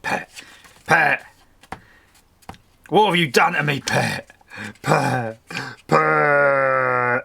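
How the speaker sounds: loud, calling Cockney husband; Frank Butcher. Retold or imitated. Crafter imitating the soap husband calling for Pat, in a loud calling voice. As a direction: bellow "Pet" like a fond, despairing husband. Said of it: "your best Frank Butcher impression".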